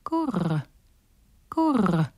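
gentle dove coo. Acted. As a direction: coo like a wood pigeon. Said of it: "Da sagten die Holztauben".

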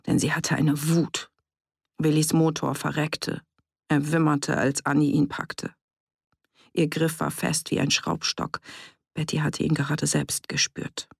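usual speaking voice

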